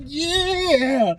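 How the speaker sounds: high, drawn-out tearful cheer; hammed-up overjoyed wail; crying and hyped. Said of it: "crying and, like, hella hyped about his ship".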